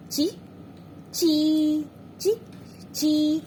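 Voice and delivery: बार-बार लंबा, ज़ोर से ची; long chee drill. Shown as a demonstration. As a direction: drill the long ची for the child, wide smile. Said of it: "बड़ी की मात्रा लगाते हैं थोड़ा लंबा खुलता है".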